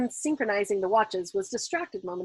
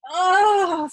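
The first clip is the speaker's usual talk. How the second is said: a higher, loud moan joining the chorus; new voice chiming in; 9 semitones higher, 9 dB louder than usual. A narrator's reading. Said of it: "somebody entirely new chimed in".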